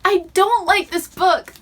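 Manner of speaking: high, slow, stress on don't; emphatic complaint. Real, not acted. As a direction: drag out I don't like.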